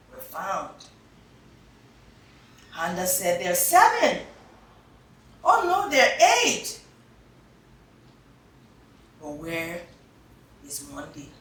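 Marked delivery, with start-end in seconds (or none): 3.4-6.8 high, loud, quick recount; high, loud counting; excited counting. Acted. Counting spoonbills. 9.2-11.3 soft, falling worry; soft worried question. Acted.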